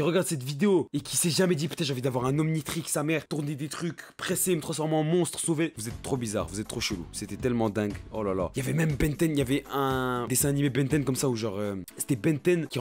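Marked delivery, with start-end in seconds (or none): none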